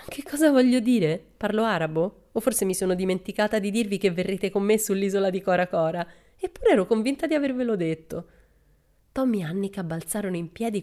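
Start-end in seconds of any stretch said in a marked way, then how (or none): 0.1-8.3 high, loud and incredulous; mock indignation. Pippi teasing Tommy and Annika, loud mock indignation. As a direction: scold them playfully for not getting it.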